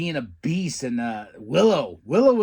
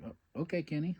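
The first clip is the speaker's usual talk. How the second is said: sarcastic soft reply; 9 dB quieter than usual. Retold or imitated. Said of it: "I was like".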